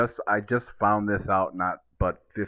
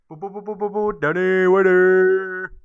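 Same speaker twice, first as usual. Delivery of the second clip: hype announcer; 11 semitones higher, 10 dB louder than usual. Pretend. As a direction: draw out the name like a ring announcer. Drumroll-style name intro.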